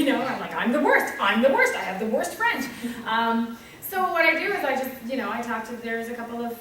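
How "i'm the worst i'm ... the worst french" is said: loud, comic self-condemnation; emphatic self-mockery. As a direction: declare it loudly, punching each claim. Loudly declaring herself the worst, laughing.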